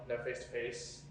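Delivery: ordinary talk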